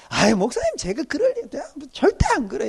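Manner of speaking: high, airy brush-off; breezy youth reply. Retold or imitated. Youth brushing off the pastor's warning, high and airy. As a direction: wave off the pastor's worry. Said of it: "이랬는데".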